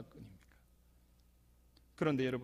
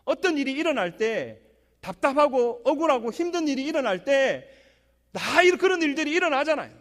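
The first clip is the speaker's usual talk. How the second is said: louder, higher exhortation from the pulpit; full force preaching; 9 semitones higher, 10 dB louder than usual. Acted. Pastor urging the congregation not to stumble, fervent. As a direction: press the point on the congregation.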